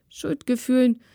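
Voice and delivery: plain reading voice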